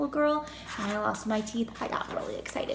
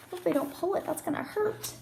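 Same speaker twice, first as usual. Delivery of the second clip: ordinary delivery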